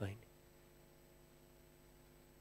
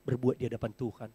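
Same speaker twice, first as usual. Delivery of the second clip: ordinary delivery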